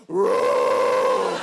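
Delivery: deep, loud monster roar; Godzilla roar. Retold or imitated. Sister startled by a grip in the dark, deep Godzilla roar. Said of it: "She sounds like Godzilla".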